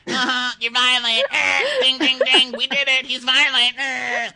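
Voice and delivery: very high, loud squealed accusation; hammed-up gotcha in a piercing voice; mock tattletale. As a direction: squeal it like a triumphant game-show buzzer. Acting gleeful tattling accusers.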